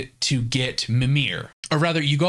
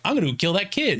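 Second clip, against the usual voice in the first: higher, petulant paranoid god; mock Odin; 6 semitones higher, faster than usual. Paranoid Odin deciding to kill the kid, higher mock voice. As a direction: toss it off like a tantrum. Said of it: "he's like".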